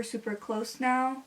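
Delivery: ordinary delivery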